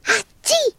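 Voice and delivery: loud high mock sneeze. The sick little dragon sneezes, acted sneeze. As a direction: act out a big sneeze.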